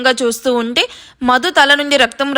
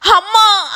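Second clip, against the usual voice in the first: pained scream; 8 semitones higher, much slower than usual. A narrator's reading. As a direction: howl out in agony. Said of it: "గావు కేక".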